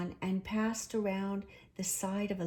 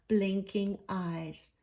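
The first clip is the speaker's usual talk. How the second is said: usual speaking voice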